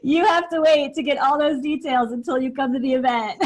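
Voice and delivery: words riding on leftover laughter; amused, laugh-filled voice; laughing tease. Real, not acted. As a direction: tease them while still giggling. Teasing listeners while laughing.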